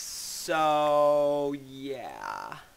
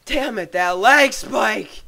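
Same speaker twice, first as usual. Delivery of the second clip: angry shout; 4 semitones higher, 10 dB louder, much slower than usual. Real, not acted. Gamer raging at lag.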